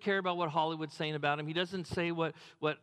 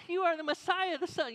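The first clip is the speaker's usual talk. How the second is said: much higher, louder, excited blurt; Peter like me; 12 semitones higher, 3 dB louder than usual. Pretend. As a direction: Peter sounding like the pastor, high and eager. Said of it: "Was Peter more like me?".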